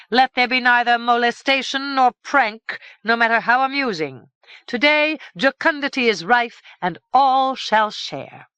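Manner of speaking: royal proclamation. A narrator's reading. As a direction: proclaim it to the whole court. King proclaims festival.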